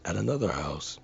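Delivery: plain reading voice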